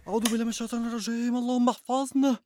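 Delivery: rushed frightened prayer. Acted. Terrified man keeps praying.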